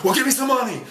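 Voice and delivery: high, loud vendor's comeback; imitated seller's indignant shout; shouted retort. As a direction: yell the demand straight back. Voices seller shouting back.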